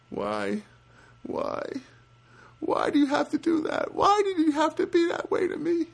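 very high-pitched plaintive protest; squeaky, put-on lament; mock anguish. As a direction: plead in a hammed-up high voice. Mock-anguished complaint to the clerk.

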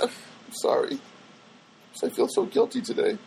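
soft, husky near-whisper; guilty confession. Real, not acted. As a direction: drop to a hushed, high apology. Apologizes for his guilty food confessions.

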